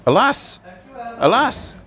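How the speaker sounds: high, hammed-up cry of alas; mock theatrical alas. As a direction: wail it like a stage tragedian, tongue in cheek. Mock lament at a viewer.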